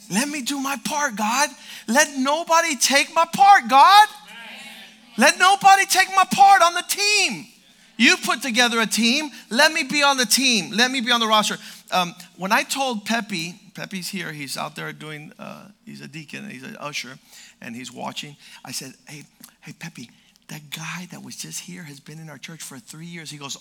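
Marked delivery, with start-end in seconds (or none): 0.1-11.6 high, loud pleading to God; fervent loud prayer. Acted. 19.1-23.3 soft secretive whisper; retold whisper. Whispering to deacon in church.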